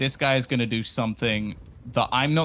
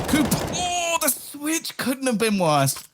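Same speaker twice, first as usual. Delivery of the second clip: shocked; 10 semitones higher, slower than usual. Real, not acted. Caster reacting to a switch.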